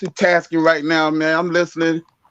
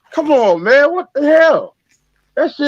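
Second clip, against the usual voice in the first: exasperated; 6 semitones higher than usual. Real, not acted. Caller exasperated at ADOS exclusion.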